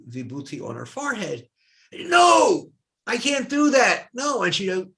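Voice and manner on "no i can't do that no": high, loud flat-out no; adamant refusal. Retold or imitated. Swami refusing her guru's ash, loud protest. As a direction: refuse it outright, voice shooting up. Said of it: "sort of an argument with her guru".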